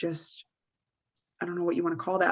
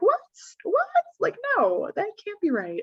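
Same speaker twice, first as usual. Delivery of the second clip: much higher incredulous protest; high disbelieving voice. Retold or imitated. Owner refusing to believe the mess, voice climbing high. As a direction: squeak it out in disbelief. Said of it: "my high voice when there's just something unbelievable".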